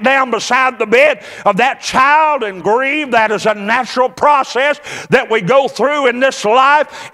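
high, driving sermon delivery; full-force preaching. Real, not acted. Preacher urging congregation past heartache, fervent high-pitched preaching. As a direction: preach it high and urgent without letting up.

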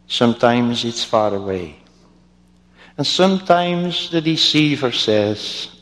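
ordinary talk

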